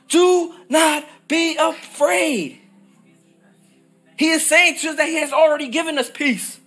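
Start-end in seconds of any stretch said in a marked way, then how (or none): 0.1-2.6 each word laid down slowly; slow emphatic repeat. Shown as a demonstration. Preacher to congregation, slow deliberate reassurance. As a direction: Do not be afraid, slow and weighty. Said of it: "repeating the verse slowly".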